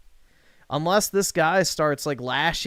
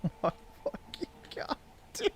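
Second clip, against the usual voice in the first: breathy whisper, fast; hushed, stunned aside; whispered disbelief; 10 semitones higher, 13 dB quieter, faster than usual. Real, not acted. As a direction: whisper it under your breath in shock. Muttering after streamer's remark.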